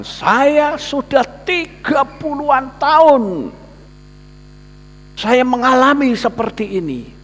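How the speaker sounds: high, slow voice of a sufferer; weary sick man. Retold or imitated. Sick man telling the pastor of his thirty years, high and slow. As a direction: drag it out, worn down by years.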